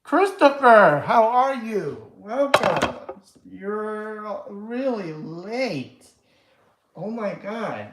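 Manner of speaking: high, slow, drawn-out welcome; excited greeting. Real, not acted. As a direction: sing out a big hello. Greeting late viewer excitedly.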